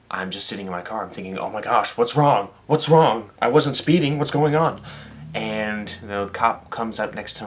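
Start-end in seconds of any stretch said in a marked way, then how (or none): 1.4-2.5 rising panic, higher and louder; frantic worry at being pulled over; panicked inner thoughts. Retold or imitated. To himself while the cop approaches, panicky and loud. 2.7-3.3 more panicked repeat. Retold or imitated. 3.4-4.8 rising panic, higher and louder; frantic worry at being pulled over; panicked inner thoughts. Retold or imitated. To himself while the cop approaches, panicky and loud.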